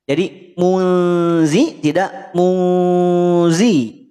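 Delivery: "muzi" first thin, then "muzi" thick with jaw lifted, slow. Shown as a demonstration. Teacher contrasting thin and thick zal. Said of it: "Bisa cara pengucapannya".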